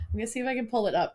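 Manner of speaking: ordinary talk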